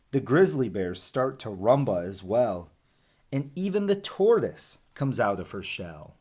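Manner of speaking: lively storybook read-aloud; animated picture-book reading; reading to children. Acted. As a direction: read the rhymes to the class with bounce. Teacher reads rhyming picture book.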